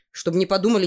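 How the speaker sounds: ordinary delivery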